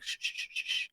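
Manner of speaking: quick repeated whispered shushes; soft shushing. Retold or imitated. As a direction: hush a child very softly. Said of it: "She's like".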